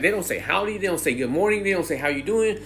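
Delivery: usual speaking voice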